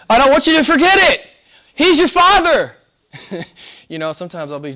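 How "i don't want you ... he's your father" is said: much higher, louder, fast cry; passionate cry. Real, not acted. Preacher pleads with the congregation, crying out. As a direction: burst out with fervour.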